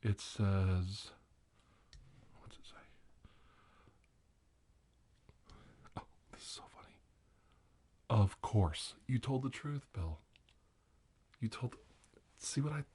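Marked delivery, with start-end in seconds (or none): 2.4-6.9 hushed giggly whisper to yourself; whisper, amused, under your breath; whispered aside. Real, not acted. Host peeking at the Magic Eight Ball, whispered.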